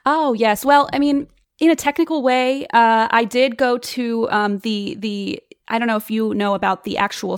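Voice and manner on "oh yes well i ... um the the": flustered. Acted. Caught out about bite marks.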